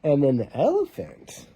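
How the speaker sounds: usual speaking voice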